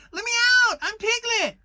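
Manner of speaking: panicked piglet begging; crying out. A narrator's reading. Piglet crying out from the bath to be let out, shrill squeal. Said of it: "cried Piglet".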